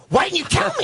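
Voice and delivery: high-pitched mock shouting; mad outburst. Lady mad at unrecognised actor, imitated high indignant yell. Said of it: "she got mad at me".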